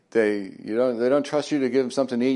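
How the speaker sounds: ordinary talk, nothing marked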